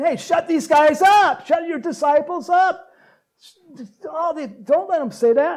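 mock Pharisees ordering silence; indignant priests. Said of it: "they're saying".

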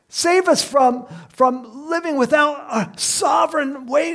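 high, loud pleading shout; imitated crowd yell. Preacher voicing the crowd begging King David, high shouted plea. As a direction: cry out for rescue at full force. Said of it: "they're yelling out".

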